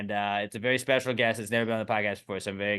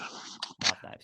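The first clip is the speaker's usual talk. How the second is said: whisper; 3 semitones lower, 10 dB quieter than usual. Real, not acted. Whispered repeat of phrase.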